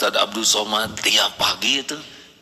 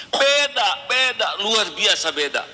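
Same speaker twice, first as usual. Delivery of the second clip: emphatic exclamation; 8 semitones higher than usual. Real, not acted. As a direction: hammer home the word beda. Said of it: "emphatic exclamation". (ditekankan tinggi dan keras)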